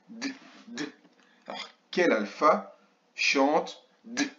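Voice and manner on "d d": D as soft, short repeated consonant sounds; son de la lettre. Shown as a demonstration. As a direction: sound out D, D, not the letter name. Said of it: "on entend D". (le son « D » isolé, bref et doux)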